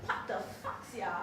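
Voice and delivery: whispered retort; keeper's muttered reply. Retold or imitated. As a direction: breathe it out, baffled. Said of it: "he's like".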